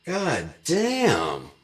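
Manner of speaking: high, loud drawn-out god damn; mock amazed exclamation. Dad marvelling at his four-year-old's chokehold. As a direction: let it burst out high and impressed.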